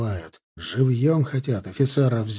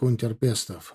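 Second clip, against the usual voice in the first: usual speaking voice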